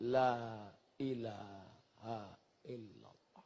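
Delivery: very soft, slow la ilaha illallah, lips barely moving; whispered zikir. Shown as a demonstration. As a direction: breathe it with the tongue only. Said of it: "bibir tak perlu bergerak".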